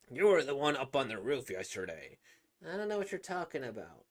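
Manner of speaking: normal talking voice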